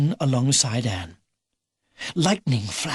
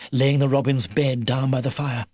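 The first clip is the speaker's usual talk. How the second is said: usual speaking voice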